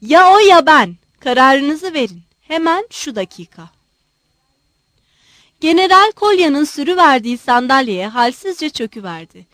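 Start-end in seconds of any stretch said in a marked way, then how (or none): 0.0-3.7 loud, heated ultimatum, easing at the end; enraged shout. A narrator's reading. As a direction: shout it at him, beside yourself with anger. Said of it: "Öfkeden kendinden geçerek Pititsin'e bağırdı".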